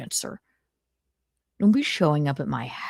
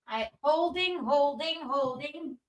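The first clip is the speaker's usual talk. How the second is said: hold music voice; 9 semitones higher, 6 dB quieter than usual. Pretend. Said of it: "please hold".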